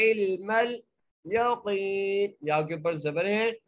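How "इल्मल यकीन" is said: very slow इल्मल यकीन, ain joined to lam; Quranic recitation. Shown as a demonstration. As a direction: draw out यकीन. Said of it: "आइन को लाम से मिलाना है".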